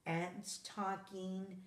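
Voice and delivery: ordinary delivery, nothing marked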